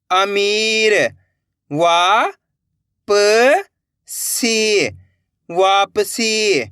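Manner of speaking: slow, drawn-out sounding out with pauses; syllable-by-syllable reading. Shown as a demonstration. Teacher showing learners how to join letters, sounded out slowly. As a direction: stretch each letter, then join the word slowly. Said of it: "एक एक अक्षर का उच्चारण करके".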